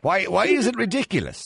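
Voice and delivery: high, sharp, incredulous question; indignant surprise. Real, not acted. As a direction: demand why, genuinely taken aback. Host challenges caller's dismissal.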